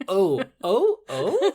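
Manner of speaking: laughing high, loud mock oh oh oh; backwards ho ho ho. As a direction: read the headband backwards, laughing. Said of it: "to me, it says".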